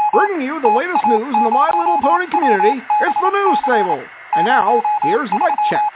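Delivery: big showy intro over music; announcer voice. Acted.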